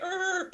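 high imitated screech of brakes; sustained, high-pitched vocal skid; tire screech. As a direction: squeal like a car pulling over. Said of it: "So the guy's like".

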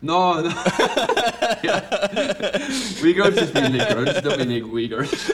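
loud, high laughter through the words; real laughing fit over a slip. Real, not acted. Laughing at his own phrasing.

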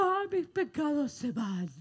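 high, slow, hammed-up wail of a weeping teen; mock weeping youth. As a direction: wail it like a teen letting go of his sins, overdone. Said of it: "los jóvenes llorando diciendo". (lamento exagerado y agudo de un joven llorando)

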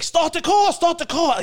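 high, loud frantic cry; mock horror-movie panic. Retelling an escape like a scary movie, mock panic. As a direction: imitate a panicked movie victim. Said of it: "in a scary movie".

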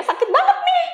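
high loud pained cry; retold pain. Bitten woman to the elder, high pained complaint. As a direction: wince it out, hurting. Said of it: "gitu ya, kayak".

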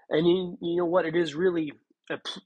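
usual speaking voice

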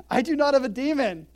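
loud, high firm denial; Jesus firmly rejecting the insult, loud; emphatic denial. Retold or imitated. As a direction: flatly refuse the charge, loudly. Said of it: "Jesus answered".

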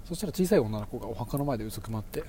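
hushed creepy ghost story. Acted. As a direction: draw them in, softly and ominously.

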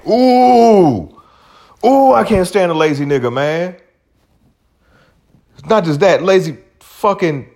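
high, loud exasperated squeal; worked-up rant. Real, not acted. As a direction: let it burst out, fed up. Ranting about lazy men.

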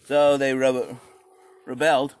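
ordinary delivery

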